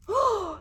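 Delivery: a sudden high-pitched shocked gasp; sharp high gasp. Acted. Lizard discovers his tail is missing, high gasp. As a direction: gasp as if you just noticed.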